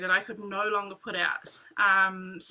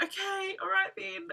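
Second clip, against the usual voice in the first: higher, softer resigned okay; reluctant okay; 9 semitones higher, 5 dB quieter than usual. Retold or imitated. Watching the buyer take the bike, higher and resigned. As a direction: let it go with a sigh.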